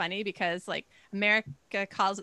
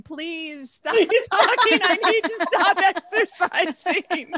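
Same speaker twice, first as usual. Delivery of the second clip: slow, high, loud pleading with laughter; treadmill listener; 8 semitones higher, 8 dB louder, slower than usual. Retold or imitated. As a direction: whine it like a worn-out jogger begging. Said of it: "somebody listening to this on a treadmill going".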